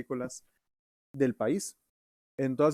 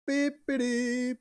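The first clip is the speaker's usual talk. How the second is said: robot beeping; 12 semitones higher, much slower than usual. Pretend. Beeping after robot greeting.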